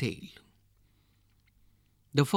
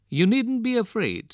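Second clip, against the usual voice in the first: higher, louder storybook reassurance; emphatic reassurance; 10 semitones higher, 5 dB louder than usual. A narrator's reading. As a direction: insist there's nothing to fear, pitched up. Farmer reassures his worried wife.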